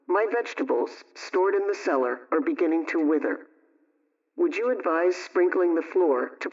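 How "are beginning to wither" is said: normal talking voice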